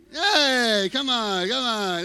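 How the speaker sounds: a loud, hammed-up pirate shout; mock pirate call. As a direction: bellow like a movie pirate. Mock pirate Davy Jones call.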